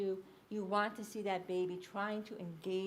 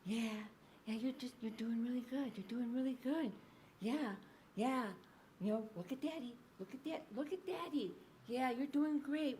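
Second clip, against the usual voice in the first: put-on dad soothing baby; 2 semitones higher, 5 dB quieter than usual. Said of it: "he's just going".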